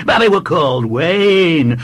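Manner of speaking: put-on high, loud childish cry; undeterred small boy. A narrator's reading. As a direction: insist loudly like a stubborn child. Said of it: "cried the boy, undeterred".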